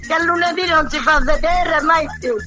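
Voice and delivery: ordinary delivery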